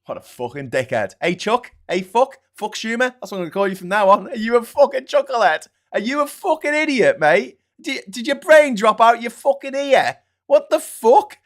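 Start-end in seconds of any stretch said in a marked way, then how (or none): 1.2-11.4 loud, much higher ranting abuse; angry shouting. Real, not acted. Comedian yelling at Chuck Schumer, furious and high. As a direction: yell insults in disbelief.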